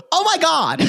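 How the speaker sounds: high, flamboyant mock exclamation; imitating a gasping diva producer; campy queeny producer. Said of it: "but he queened the fuck out".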